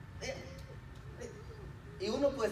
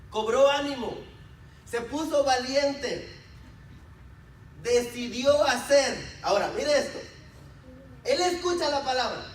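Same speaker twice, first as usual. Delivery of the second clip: raised, emphatic preacher's voice; rousing pulpit delivery; forceful preaching; 7 semitones higher, 6 dB louder than usual. Real, not acted. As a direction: proclaim it to the congregation, pausing between phrases.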